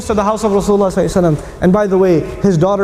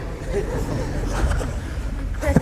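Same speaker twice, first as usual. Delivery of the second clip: breathy laugh; 6 semitones higher, 10 dB quieter than usual. Real, not acted. Laughs at the insult scene.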